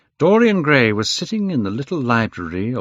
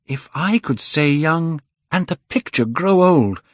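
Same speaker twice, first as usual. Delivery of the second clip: high, louder, fervent; passionate vow; 9 semitones higher, 4 dB louder than usual. A narrator's reading. Dorian vows his soul for youth, high and loud. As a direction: swear it with everything.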